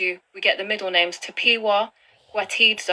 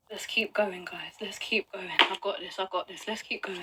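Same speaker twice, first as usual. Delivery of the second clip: much softer, talking herself through it; self-soothing; 4 semitones lower, 12 dB quieter than usual. Real, not acted. Upset vlogger quietly coaxing herself to carry on. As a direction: murmur it to steady yourself. Calming herself under breath.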